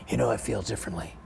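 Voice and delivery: a calm guru talking softly; soft-spoken Seagal. Retold or imitated. Seagal retold, soft spiritual.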